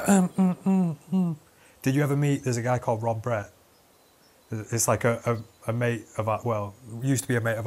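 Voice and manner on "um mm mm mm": higher mock hums, lost for words; tongue-tied hemming. Fake veteran caught out by a soldier, tongue-tied. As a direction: hem and hum like a caught bluffer.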